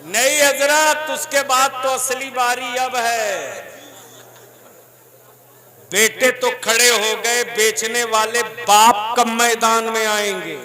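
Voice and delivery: loud, high, full-force sermon; fiery preaching. Real, not acted. Preacher rousing unmarried young men, full force. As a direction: whip the crowd up.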